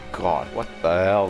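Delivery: usual speaking voice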